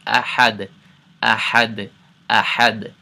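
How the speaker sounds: أَحَدٌ with a clearly bounced final D. Shown as a demonstration. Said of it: "we bounce it".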